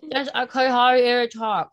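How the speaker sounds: slurred, slow numb-lipped speech; numb lips slurring. Retold or imitated. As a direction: talk like your lips are frozen numb. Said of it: "it sounds like I'm slurring".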